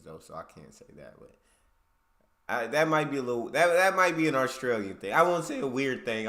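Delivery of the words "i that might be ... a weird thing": louder emphatic. Real, not acted. Emphatic correction.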